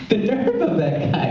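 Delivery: normal talking voice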